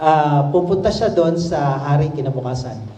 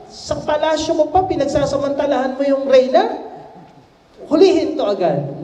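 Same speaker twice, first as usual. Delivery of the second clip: angry; 9 semitones higher than usual. A narrator's reading. King returns, enraged accusation.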